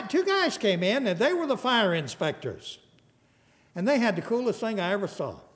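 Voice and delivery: ordinary talk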